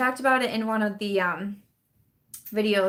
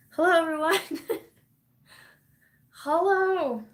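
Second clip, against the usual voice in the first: ordinary delivery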